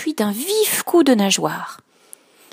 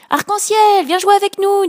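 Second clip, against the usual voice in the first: little fish calling; 11 semitones higher, 5 dB louder than usual. Acted. Fish call out to Rainbow.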